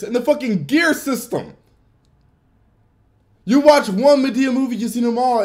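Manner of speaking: ordinary talk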